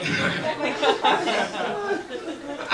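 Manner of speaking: high breathy chuckle at himself; own laugh. Real, not acted. Comic cracking up at his own joke, chuckling.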